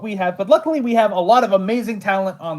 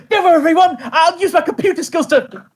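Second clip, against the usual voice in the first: much higher, louder, laughing character voice; hammed-up character line; 8 semitones higher, 3 dB louder than usual. Recalling a favourite recorded line, high giddy laughing character voice. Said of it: "the Jubilee bit is one of my favorites".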